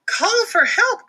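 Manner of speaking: high, slow, loud cry. Acted. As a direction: plead in fear. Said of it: "cried Bibi".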